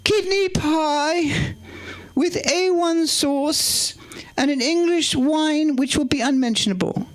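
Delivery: very high, slow posh English Queen impression; the Queen's voice. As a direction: play the Queen reading a menu, high and drawn out. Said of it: "do it in the Queen's voice".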